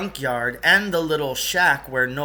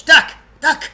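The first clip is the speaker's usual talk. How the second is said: sharp high yelled warning; shouted alarm, high and loud; urgent shout; 14 semitones higher, 12 dB louder than usual. Acted. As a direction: yell it to make them hide. Sudden warning to hide.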